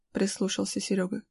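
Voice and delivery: plain reading voice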